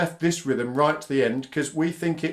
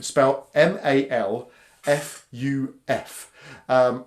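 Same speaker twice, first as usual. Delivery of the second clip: normal talking voice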